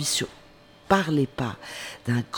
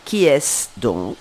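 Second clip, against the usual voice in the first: slow, louder stern demand; a severe queen questioning; slow and stern, a little louder; 6 dB louder, slower than usual. Acted. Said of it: "d'un ton de voix sévère".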